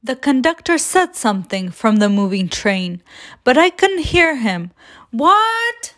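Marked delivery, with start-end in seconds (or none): 5.1-5.9 high, mock-yelled question. A narrator's reading. Child yells to conductor on moving train, high mild yell. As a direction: call it out high, without much extra volume. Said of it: "I yelled out".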